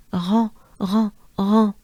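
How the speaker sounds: "rond" with a nasal French on vowel. Shown as a demonstration. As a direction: say "rond" like in restaurant. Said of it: "with a French accent, very French".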